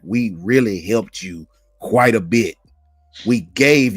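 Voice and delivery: slow smug imitation of a fan; mocking rival fan. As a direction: slowly gloat the line. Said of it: "fan talking like".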